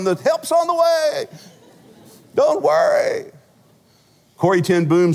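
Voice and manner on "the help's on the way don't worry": high, slow, soothing voice from heaven; reassuring heavenly voice. Pretend. Holy Spirit reassuring a panicked preacher, high and slow. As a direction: call down calm help from above. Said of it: "Holy Spirit going".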